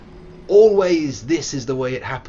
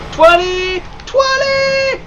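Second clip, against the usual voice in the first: very high, loud falsetto cry; hammed-up squealed shout; mock shriek; 10 dB louder, much slower than usual. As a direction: shriek it out shrilly.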